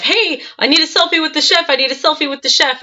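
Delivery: high-pitched imitation of calling out; mock shouting. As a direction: badger someone across the room, mock shout. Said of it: "kept shouting out to the chef".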